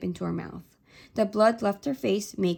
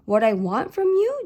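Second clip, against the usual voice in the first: confused; 5 semitones higher, 6 dB louder than usual. A narrator's reading. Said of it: "Joseph looked confused".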